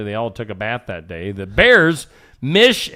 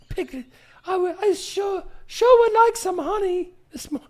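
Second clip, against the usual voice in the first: Winnie the Pooh. Acted. Host clowning as a honey-loving bear, in a high put-on voice. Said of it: "I'm Winnie the Pooh".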